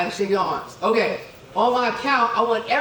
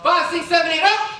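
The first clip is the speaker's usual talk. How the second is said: shouted count-in; 9 semitones higher, 6 dB louder than usual. Real, not acted. Dance count-in to a room.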